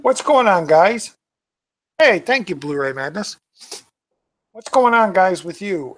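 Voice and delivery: high, loud greeting. Real, not acted. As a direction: call out to the chat.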